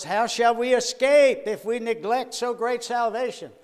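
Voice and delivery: loud, high, ringing preacher's delivery; preacher's emphatic shout. Retold or imitated. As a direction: boom the verse out like a jail preacher. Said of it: "he kept emphasizing those three words".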